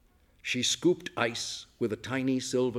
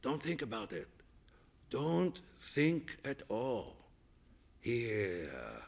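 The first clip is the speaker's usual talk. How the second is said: slow, soft, hypnotic coaxing; soft purr; 8 dB quieter, slower than usual. A narrator's reading. Chef seducing the guest with food, soft slow purr. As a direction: lull her out of thinking. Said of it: "he purred".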